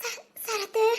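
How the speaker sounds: soft tearful stammer of the name; crying. Acted. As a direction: hold back a sob on the name. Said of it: "அழாதடி, எதுக்கு அழுகுற?".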